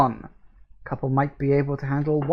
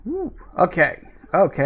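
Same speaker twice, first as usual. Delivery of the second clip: ordinary talk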